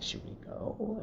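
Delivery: retold whispered question; very soft, voiceless secretive aside; furtive whisper. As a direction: whisper it so only your friend hears. Friends secretly conferring, wary of stranger.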